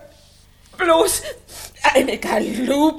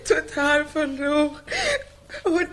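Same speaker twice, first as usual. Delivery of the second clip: unter Tränen, in höherer Stimme; weinend klagen; 7 semitones higher than usual. Acted. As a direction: klag es schluchzend heraus. Oksana klagt über ihren Mann. (weinerlich und verzweifelt)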